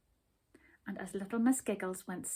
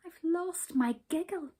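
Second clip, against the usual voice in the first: high, miserable little voice; 8 semitones higher, 3 dB louder than usual. A narrator's reading. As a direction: tell it like a child close to tears. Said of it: "she explained miserably".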